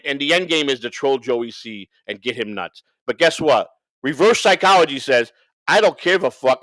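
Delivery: ordinary talk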